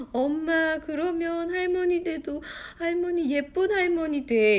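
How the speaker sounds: put-on high, slow, whiny child voice; imitated tearful child. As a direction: plead like a sobbing little kid. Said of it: "그랬더니 아이가". (울먹이는 아이 흉내)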